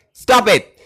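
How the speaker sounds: ordinary delivery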